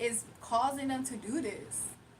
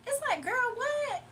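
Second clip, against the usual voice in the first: incredulous; 11 semitones higher, slower than usual. Real, not acted. Vlogger reacting in disbelief to a viewer comment, high and incredulous. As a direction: squeak out the disbelief.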